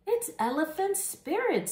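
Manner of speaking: frightened animals spotting a new fire in the sky. Acted. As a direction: cry it out in fright. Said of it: "said the frightened animals".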